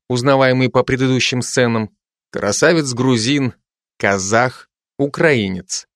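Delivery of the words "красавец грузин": plain reading voice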